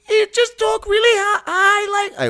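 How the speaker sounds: piercing falsetto with a British slip; high character voice gone British. Acted. Comic mimicking Mark McKinney, high British-tinged falsetto. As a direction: squeal it high, sliding into British. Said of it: "I went British".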